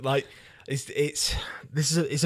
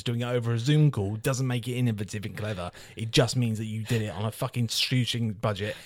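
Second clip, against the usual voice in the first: usual speaking voice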